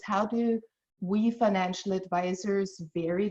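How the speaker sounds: ordinary delivery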